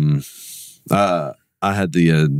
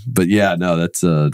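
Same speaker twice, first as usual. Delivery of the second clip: ordinary talk, nothing marked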